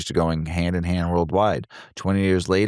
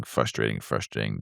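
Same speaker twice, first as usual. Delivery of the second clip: "frustrating" said lower and softer each time; fading repetition; 7 semitones lower, 5 dB quieter than usual. Acted. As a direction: let "frustrating" sink and fade away. Repeating word, fading down.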